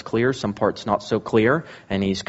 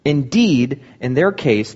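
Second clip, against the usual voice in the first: ordinary delivery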